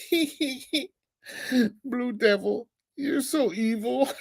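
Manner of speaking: mocking giggly impression. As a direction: mimic him cackling at himself like a maniac. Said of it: "And you can hear him go".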